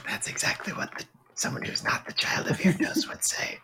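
teasing hushed whisper, rising at end; suspicious whisper. Acted. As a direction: sly whisper. Suspicious whisper through door.

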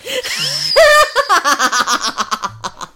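high, loud burst of laughter; long cackle tailing off; loud giggling fit. Real, not acted. Host admits the book is hot, loud high laughter.

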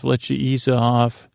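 usual speaking voice

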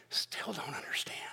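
under-breath whisper; whispered aside. Real, not acted. Preacher whispering to the congregation. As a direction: drop to a whisper.

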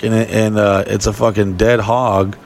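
ordinary talk, nothing marked

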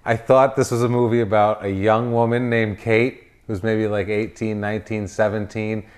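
ordinary talk

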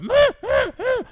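very high squeaky bleat; squeaky meh. Retold or imitated. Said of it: "We'll more go".

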